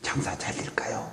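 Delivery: unvoiced anxious whisper; stage whisper. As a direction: whisper the worried question. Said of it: "이렇게 얘기하는 거예요".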